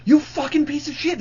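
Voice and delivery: high-pitched furious yelling at the guy; retold angry yell. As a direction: yell it up high like you're screaming at him. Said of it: "He's, like, yelling at this guy".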